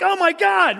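mock horror at hearing Josh's name; shocked. Stranger reacting to hearing they're with Josh, in mock horror. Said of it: "He's like".

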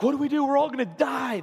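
high, loud mock panic; acted-out terror, pitch thrown up; freaking out. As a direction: cry out as a panicking crowd. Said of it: "they're all freaking out".

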